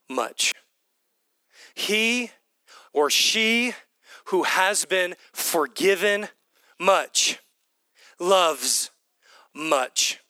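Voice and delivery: slow, word by word with long pauses; punctuated point. Retold or imitated. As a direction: let each phrase land on its own before the next. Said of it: "he punctuates the point".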